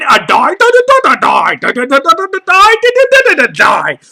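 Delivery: rapid "da-da-die" babble swooping high, then dropping; mock rap flow. Comedian imitating a rapper doing her thing, in swooping gibberish. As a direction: parody a rap flow in sing-song nonsense syllables. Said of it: "doing your thing".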